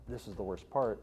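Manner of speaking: ordinary talk, nothing marked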